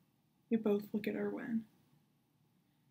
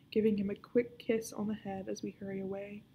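ordinary delivery, nothing marked

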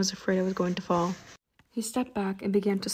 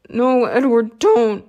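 desperate plea; 3 semitones higher, 11 dB louder, slower than usual. A narrator's reading. Injured Bella begging Edward to stay.

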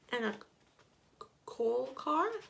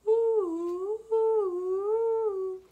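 high, loud, sustained hooting horn; long loud "woo" up high; car horn imitation; 10 semitones higher, 10 dB louder, much slower than usual. A narrator's reading. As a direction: hoot like an old automobile horn. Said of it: "Automobile horns".

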